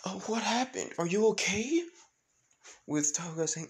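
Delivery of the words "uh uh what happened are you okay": high, stammering worried question; acted worried child; Eri. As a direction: ask anxiously in a small raised voice. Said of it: "With Eri saying".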